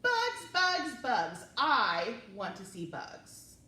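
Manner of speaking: lively, expressive read-aloud; reading to children. Acted. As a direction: read it to little kids with wonder. Reading picture book aloud.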